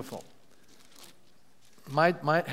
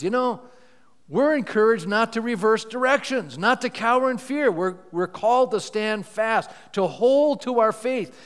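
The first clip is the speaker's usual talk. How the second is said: higher, lifted preacher's push, slowed at the end; rousing preaching; 6 semitones higher, 4 dB louder than usual. Acted. Pastor urging the church not to cower, in a lifted preaching voice. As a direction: urge them on like a pastor. Urging congregation to stand fast.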